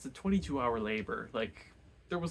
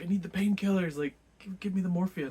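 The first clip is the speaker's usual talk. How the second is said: frantic plea; 8 semitones higher, 7 dB louder than usual. Retold or imitated. Levin frantic at the shop.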